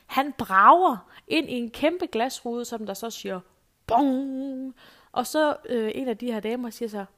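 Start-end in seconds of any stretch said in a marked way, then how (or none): 3.9-4.8 a louder, higher mock bong of glass; imitated bong. The glass pane hit by the smoker, a mock bong.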